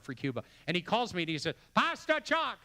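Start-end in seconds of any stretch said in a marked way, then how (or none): 1.7-2.6 much higher, eager and bubbling over; excited Pastor Yuan. Retold or imitated. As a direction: squeal it like an overexcited friend. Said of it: "that's how he talks".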